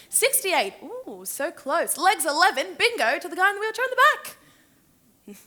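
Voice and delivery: loud, high, showy calls; lively club bingo announcer; bingo caller. Acted. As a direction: call out the winner across the hall. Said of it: "to announce the bingo".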